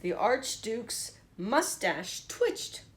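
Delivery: plain reading voice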